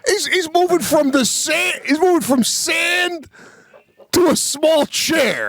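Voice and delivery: high, shouted mock indignation; mock outrage. Real, not acted. Mocking the fuss over his son's last school day, high mock rant. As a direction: rant it like it's absurd.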